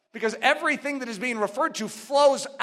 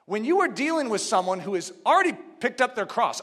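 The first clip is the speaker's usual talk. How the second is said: ordinary talk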